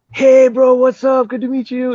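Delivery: high, hyped-up hello to a new friend; excitable greeting. Retold or imitated. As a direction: greet him in a high put-on voice. Imitating high-energy Marco's greeting.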